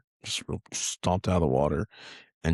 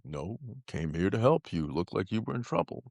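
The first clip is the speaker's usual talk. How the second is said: a hint of a laid-back giant turtle, softer; softly and easy, like a kind old turtle; turtle's drawl; 5 dB quieter than usual. Giant sea turtle to a scared boy, soft easy drawl. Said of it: "he has a really deep, slow drawl".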